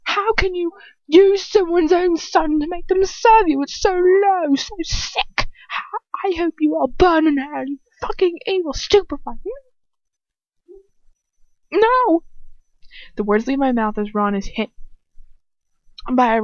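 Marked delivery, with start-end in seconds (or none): 0.0-9.4 high, loud, acted anguished rage; furious outburst. A narrator's reading. Ron raging over his parents, furious. 11.7-12.2 very high, loud shout; horrified cry. A narrator's reading. Heroine seeing Ron hit, alarmed shout.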